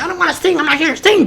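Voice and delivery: very high, whiny mock voice; very high mock protest as Keely. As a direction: parody her refusing to sing. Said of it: "Keely was talking all that shit".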